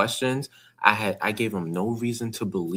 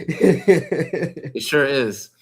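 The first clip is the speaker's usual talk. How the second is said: laughing; 6 semitones higher, 5 dB louder, much slower than usual. Real, not acted. Agreeing through laughter.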